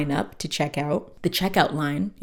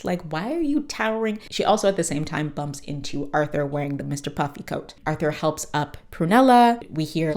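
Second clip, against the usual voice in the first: ordinary talk, nothing marked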